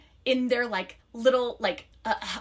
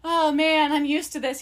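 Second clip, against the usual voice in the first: higher, hammed-up rehearsed lament; put-on woe; 6 semitones higher, 4 dB louder than usual. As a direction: act the neglected girlfriend, laying it on. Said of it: "and you be like".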